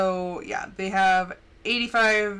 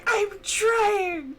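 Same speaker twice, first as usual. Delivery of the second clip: whiny cry; 11 semitones higher than usual. Retold or imitated. Child protesting to her mom, crying out. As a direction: wail it like a frustrated kid.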